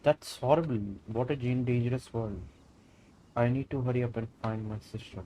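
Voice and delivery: normal talking voice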